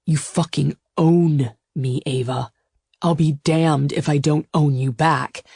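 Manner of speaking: intense, weighted male suitor's voice; fierce possessive lover. Acted. Suitor claims her as his own, fierce and weighted. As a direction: stake the claim, leaning on key words. Gideon's voice.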